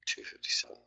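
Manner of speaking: whispered price under the breath. Real, not acted. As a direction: breathe the number to yourself.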